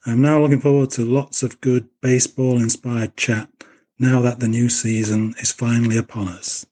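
normal talking voice

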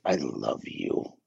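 a grudging, deadpan delivery; flat, cold. Shown as a demonstration. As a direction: drop "I love you" low and slow, dismissive. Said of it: "two totally different meanings".

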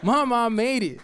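high and laughing with delight; giddy joy. Retold or imitated. Seeing his name credited at last, giddy and laughing. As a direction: laugh it out, thrilled and proud.

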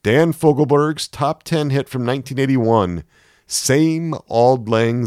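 bright on-air patter, spinning up the song; radio DJ. A narrator's reading. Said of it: "the D J was saying".

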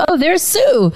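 high, bright call of recognition; guy's surprised call. Retold or imitated. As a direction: call out like spotting a friend. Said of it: "the other two guys goes".

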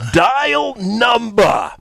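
put-on high, extremely drawn-out New Jersey accent; exasperated New Jersey caller, stretching each word; thick New Jersey accent. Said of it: "in his thick New Jersey accent".

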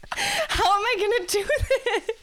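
high, rising imagined panic to the parents; mock panic. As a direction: play the panicked friend, half joking.

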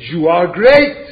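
loud self-flattery to a mirror; hammed-up loud praise to the mirror; mock self-praise. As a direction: boom it at yourself like a proud show-off. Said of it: "I stand in front of a mirror and I sell, say".

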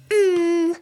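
loud "mm" with tongue stuck out. Shown as a demonstration. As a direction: hum while sticking tongue out. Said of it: "Sticking out my tongue".

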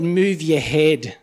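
slow, measured inner voice; calm guiding voice. Retold or imitated. The inner voice warns the young driver, slow and steady. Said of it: "And that same voice then just said".

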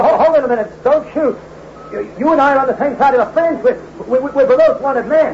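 high panicked pleading, words stumbling. Acted. Cornered man to gunman, high frightened pleading. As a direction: beg for your life at gunpoint. Gunpoint, pleading for his life.

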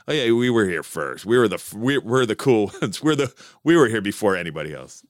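low, lightly voiced jaded scenester; imitated old punks. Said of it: "like the old punks in the back".

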